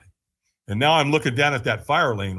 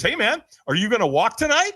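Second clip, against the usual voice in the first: higher-pitched imitated question; curious stranger; 8 semitones higher than usual. Stranger asks him about walking tonight, imitated curiosity. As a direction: pitch it up as a friendly retold invitation.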